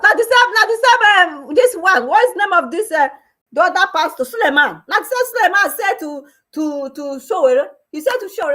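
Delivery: high and loud, worked-up telling; heated excited recounting. Real, not acted. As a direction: fire up the story. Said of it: "excited recounting".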